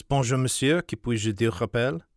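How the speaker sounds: quick, lightly voiced French footman; rapid French. Footman greeting the American visitor, rapid French. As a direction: rattle it off in French. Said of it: "addresses Sparks in rapid, untranslated French".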